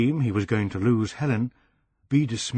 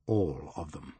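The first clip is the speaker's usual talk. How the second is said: 1 ominous whisper; 6 semitones lower, 8 dB quieter than usual. A narrator's reading. Narrator's ominous aside.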